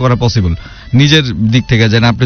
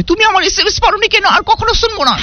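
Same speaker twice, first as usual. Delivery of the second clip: much higher, squeaky mock girlfriend whine; whiny girlfriend. Host mocking a girlfriend's complaint to listeners, in a high squeaky whine. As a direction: pout it out like a sulking girlfriend.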